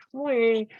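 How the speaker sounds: a buzzy high-pitched squeal; shrill squeak. Shown as a demonstration. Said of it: "like if a harmonica complained".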